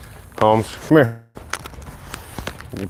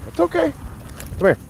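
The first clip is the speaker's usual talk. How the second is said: soothing dog; faster than usual. Real, not acted. Calming a spooked dog.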